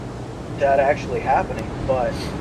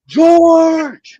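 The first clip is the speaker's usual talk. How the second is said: high, drawn-out yell of a name; mock shout; 12 dB louder than usual. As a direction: belt it out high and loud, hamming it up.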